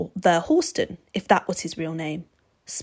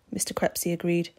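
plain reading voice

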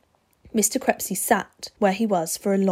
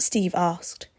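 ordinary delivery, nothing marked

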